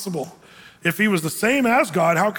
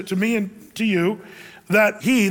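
normal talking voice

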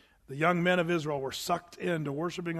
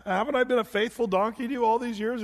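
higher-pitched donkey voice; pleading donkey; 5 semitones higher than usual. Acted. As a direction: plead like a wronged, loyal donkey. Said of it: "the donkey says".

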